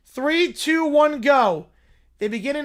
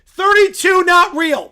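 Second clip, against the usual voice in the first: higher, louder, slow dim bear shout; 8 semitones higher, 11 dB louder than usual. Acted. Little Brown Bear shouting about romance, slow and loud. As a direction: bellow it like a lovesick bear.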